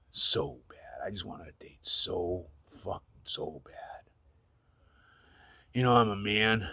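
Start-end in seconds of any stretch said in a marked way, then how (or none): 0.1-4.1 whispering. Real, not acted. Confessing longing.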